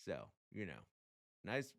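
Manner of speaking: normal talking voice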